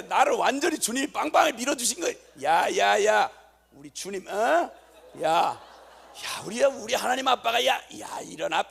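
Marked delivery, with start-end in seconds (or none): 2.4-8.6 loud rousing shout; triumphant calling. Retold or imitated. Father shouting his daughters awake to celebrate, loud triumph. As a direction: wake them with a victory call.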